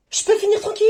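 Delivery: high, exasperated question; exasperated demand. Acted. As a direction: demand to be left alone, pitch jumping up. Interrupted reader demands peace.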